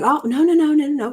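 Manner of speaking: high, loud no-no-no to stop the tears; alarmed fussing. Retold or imitated. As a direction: rush in to shush someone crying. Lady Danbury stops a crying woman.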